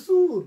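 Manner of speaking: loud, high সুর for চোর, "soor"; regional pronunciation. Retold or imitated. Teacher mimicking local dialect for a laugh, regional pronunciation. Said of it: "চোরকে বলে সুর".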